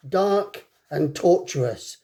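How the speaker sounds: ordinary delivery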